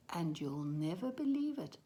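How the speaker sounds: usual speaking voice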